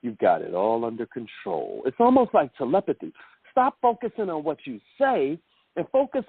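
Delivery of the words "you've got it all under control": lower, calm late-night DJ tone. Shown as a demonstration. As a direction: reassure them like a smooth radio host.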